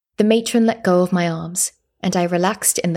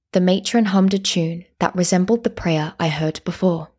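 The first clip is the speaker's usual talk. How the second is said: usual speaking voice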